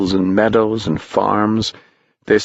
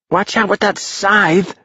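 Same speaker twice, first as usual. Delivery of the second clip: sharp, much higher call of alarm; warning shout; 13 semitones higher than usual. Acted. Boy warning the Death boy about scythe, high alarmed call. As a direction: yell a quick warning.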